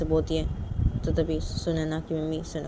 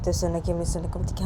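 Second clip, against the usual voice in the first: usual speaking voice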